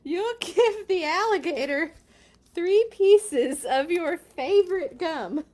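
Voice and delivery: loud, high dramatic read-aloud; urgent storybook narration. A narrator's reading. Reader telling child to feed alligator gum, loud urgent narration. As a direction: push each phrase out with urgency.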